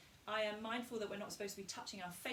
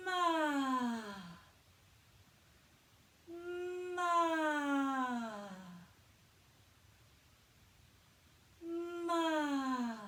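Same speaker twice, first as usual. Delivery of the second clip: louder, lifted ma; heart resonance ma; 4 semitones higher, 4 dB louder, much slower than usual. Shown as a demonstration. Voice coach leading the ladder, open ma. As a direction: sing out ma from the heart. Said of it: "upper resonators".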